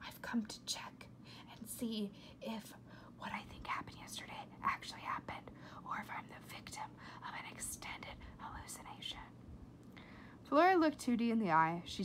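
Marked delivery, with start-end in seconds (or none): 0.1-9.2 steady hushed whisper. A narrator's reading. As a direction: keep it secret, whisper. Secret talk continues whispered.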